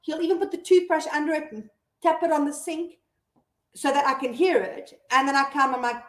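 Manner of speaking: ordinary talk, nothing marked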